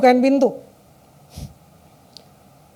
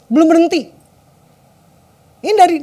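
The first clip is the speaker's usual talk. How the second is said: normal talking voice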